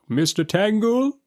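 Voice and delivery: high, slow questioning judge; Chancellor's query. A narrator's reading. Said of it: "says the Lord High Chancellor".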